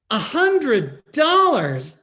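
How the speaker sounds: high, loud, slow disbelief; shocked disbelief. Acted. Wife reading the contract, loud and slow in disbelief. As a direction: draw it out in shock.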